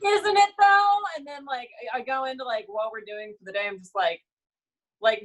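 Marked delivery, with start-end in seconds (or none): 0.1-1.0 loud, high singsong imitation; high-pitched hammed-up agreement; mock cheery reply. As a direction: act out coworkers chirping back. Coworkers' cheery answer to greeting.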